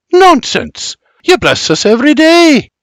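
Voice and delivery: hearty father barking Nonsense. Acted. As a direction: wave off his guilt forcefully. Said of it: "Bibi barks".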